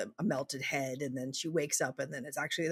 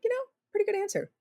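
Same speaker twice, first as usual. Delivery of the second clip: mock approval; much faster than usual. Joking approval, retold.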